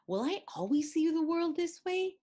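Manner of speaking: high and loud thrilled question; full of wonder and delight; excited wonder. A narrator's reading. Child asking about her fortune-cookie world, excited.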